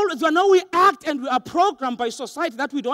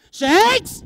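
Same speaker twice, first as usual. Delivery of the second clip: higher, louder call from a parent; a parent's raised-voice shout of a name; 7 semitones higher, 6 dB louder than usual. Retold or imitated. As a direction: summon the child with one sharp, loud word. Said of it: "when my mom or dad says to me".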